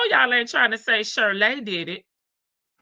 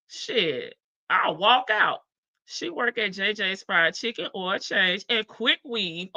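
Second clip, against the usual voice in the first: usual speaking voice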